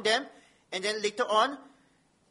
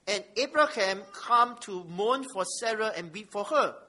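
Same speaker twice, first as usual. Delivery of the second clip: slow, emphatic Bible reading; measured public reading of holy text; scripture reading; 4 dB louder, slower than usual. Acted. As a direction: read it out firmly to the congregation, weighting key words. Reads scripture aloud.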